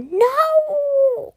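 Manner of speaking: very high, loud cry of panic; frightened cry. A narrator's reading. Boy crying out as he is thrown into the air. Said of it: "cried Thomas as he flew upwards".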